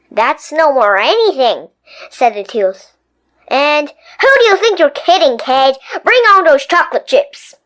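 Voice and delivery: sly taunting tooth; mocking tooth. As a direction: tease the kid.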